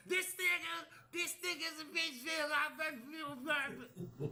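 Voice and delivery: high, stretched-out ranting voice; mocking imitation. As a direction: mimic a girl ranting on camera. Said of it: "talking about".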